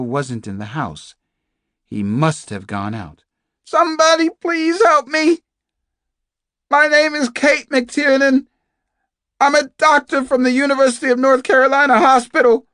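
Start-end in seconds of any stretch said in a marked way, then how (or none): 3.6-12.7 high, loud captive's call; screaming for help. Acted. Captive doctor telling unseen listeners who she is, screaming. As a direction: shout every word so that someone hears. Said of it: "The screams continued".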